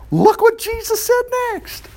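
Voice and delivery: high, drawn-out excitement; high, slow preacher's build-up; excited build-up. Real, not acted. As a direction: pitch it way up and slow down for suspense. Building up to Jesus's reply.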